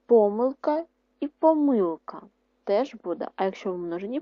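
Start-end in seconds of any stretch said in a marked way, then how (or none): 0.1-2.2 помилка said twice, first stressed on по-, then on -ми-, higher and louder; showing double stress. Shown as a demonstration. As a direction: contrast the two stresses in помилка. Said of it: "скільки знаків наголосу стоїть над словом помилка". (два наголоси в слові помилка)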